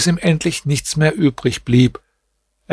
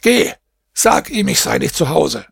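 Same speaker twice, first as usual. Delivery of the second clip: a sudden high barked order to the slave, ordering her off; shouting; 3 semitones higher than usual. A narrator's reading. Master yells at his slave girl to send Nureddin away, high angry shout. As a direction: snap it out, irritated, to get rid of the caller. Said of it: "Ihr Herr schrie sie an".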